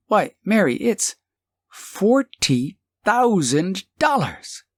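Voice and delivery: a husband hearing of a fortune, awestruck; amazed. Acted. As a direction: savor the sum in wonder. Husband stunned by money.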